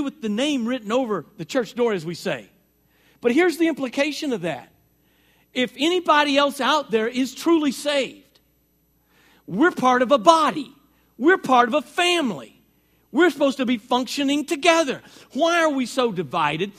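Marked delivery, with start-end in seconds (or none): 5.5-16.7 raised, louder sermon push; preaching at full force. Real, not acted. Pastor urging his church to unity, preaching louder. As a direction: press the congregation hard on unity.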